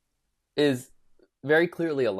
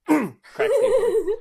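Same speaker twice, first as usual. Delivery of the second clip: mock TikTok voice; 6 dB louder, slower than usual. Quoting a TikTok voice.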